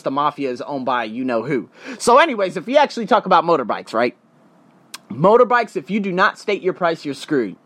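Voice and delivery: ordinary talk, nothing marked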